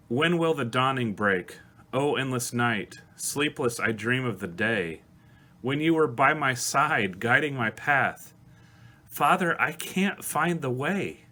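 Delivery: lyrics read with real feeling, swelling toward the end; slow, heartfelt recitation rising into pleading; emotional reading. Real, not acted. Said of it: "I'm sorry, I'm getting emotional".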